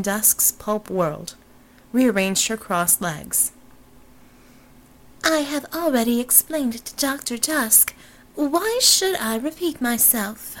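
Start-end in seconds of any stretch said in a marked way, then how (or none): 5.2-10.6 higher, haughty society-lady voice; haughty heiress. Acted. Robinson dismissing Fata, high haughty heiress voice. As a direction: play her as a high, aloof blonde.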